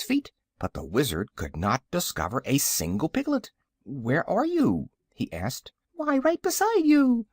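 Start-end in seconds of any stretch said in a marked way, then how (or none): 3.8-4.9 slow, raised-pitch searching question; searching call. A narrator's reading. Wizard searching for missing piglets. 5.9-7.3 very high, squeaky little creature; teeny high-pitched piglet squeak; tiny piglet voice. A narrator's reading. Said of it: "spoke a tiny voice".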